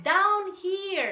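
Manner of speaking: high, loud sneering call. A narrator's reading. Sly weasel leading the animals to the hole, sneering. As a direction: sneer it, pointing the way. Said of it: "he said with a sneer".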